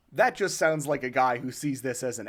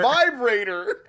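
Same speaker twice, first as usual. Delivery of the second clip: dramatic reveal; 7 semitones higher, 6 dB louder, slower than usual. Pretend. Big reveal of answer.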